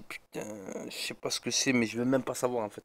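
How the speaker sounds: hushed fear. Retold or imitated. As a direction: mutter it under the covers.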